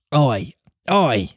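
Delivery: blithe pirate crewman. Acted. Jukes obeying his captain's order, blithely. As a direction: answer the captain without a care. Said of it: "said Jukes blithely".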